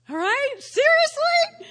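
high, loud seriously; drawn-out disbelief; incredulous. Real, not acted. Preacher to congregation, high loud incredulous seriously.

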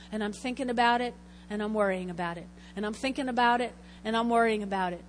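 low, quick, repetitive muttering; worried rumination. Acted. Preacher shows getting sucked into worry, quick low looping. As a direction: circle round the same thought, quick and low.

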